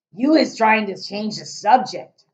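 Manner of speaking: loud, slow stern giant. Acted. Giant scolding Sophie, stern. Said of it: "the giant said sternly".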